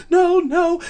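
high strained no, no; breathless mock refusal. Choking date refusing an ambulance, high and breathless. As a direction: wave off help while gasping.

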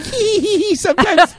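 high, teasing little voice; cutesy giggly voice. Retold or imitated. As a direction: play it coy and giggly. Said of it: "She's like".

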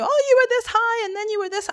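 high, gushing quote; fond neighbour's exclamation. Retold or imitated. People down the road remembering a child, mock gushing. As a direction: imitate someone marvelling at how you've grown.

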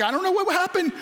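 mock stunned bewilderment; dazed bewilderment. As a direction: play it lost and confused after burning out. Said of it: "you're like".

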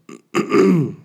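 harsh loud cough; coughing fit. Real, not acted. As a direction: cough hard into the mic. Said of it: "sorry, I'm coughing down the phone".

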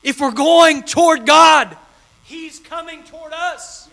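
high, slow, held sermon cry; lifted slow preaching peak; preacher's climax. Acted. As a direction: stretch it out over the crowd.

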